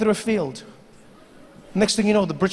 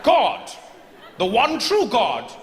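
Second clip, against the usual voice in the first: pompous fussy courtier; 4 semitones higher, much slower than usual. Acted. British soldier declaring.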